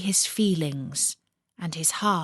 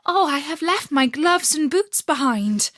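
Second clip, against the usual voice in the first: put-on higher, dismayed child's cry; little girl crying out; 7 semitones higher, 3 dB louder than usual. A narrator's reading. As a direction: cry out like a little girl who forgot something. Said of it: "cried Gerda".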